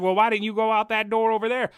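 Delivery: high, loud, challenging question; nagging store worker. Retold or imitated. Imitating the worker challenging a customer, high and accusing. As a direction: demand it accusingly. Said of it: "She's like".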